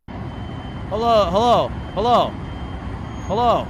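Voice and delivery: calling hello loud to beat the loud video; loud announcer-style hellos into the mic. Real, not acted. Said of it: "this video is loud".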